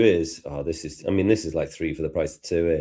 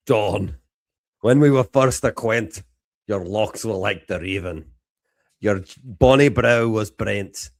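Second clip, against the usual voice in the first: best Scottish accent. Shown as a demonstration. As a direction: recite a poem in put-on Scottish. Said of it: "Scottish accent?".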